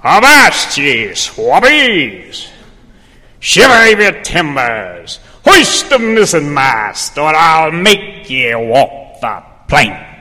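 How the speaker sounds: loud gruff pirate. Acted. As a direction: bellow it like a pirate captain. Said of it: "introduce you to Sam Audie the Pirate".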